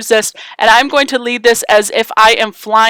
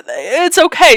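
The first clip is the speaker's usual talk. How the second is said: ordinary delivery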